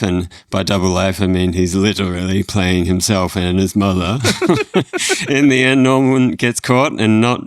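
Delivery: New York stand-up voice with laughs; a hint of New York accent, breaking into laughter; Jerry Seinfeld impression. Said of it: "in the voice of Jerry Seinfeld".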